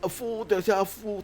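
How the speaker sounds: put-on high, loud nonsense British accent; mock written accent. Player mocking a note written in dialect, silly British accent. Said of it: "like he was talking in the accent".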